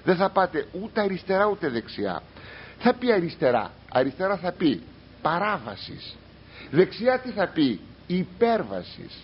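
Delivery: ordinary talk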